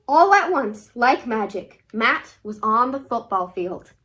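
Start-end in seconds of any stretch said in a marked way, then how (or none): none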